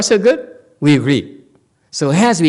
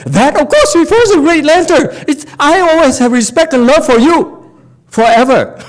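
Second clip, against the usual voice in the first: high, louder fawning voice; flattering disciple; 10 semitones higher, 5 dB louder than usual. Acted. Disciple flattering his master to dodge him, high and fawning. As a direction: lay the flattery on thick.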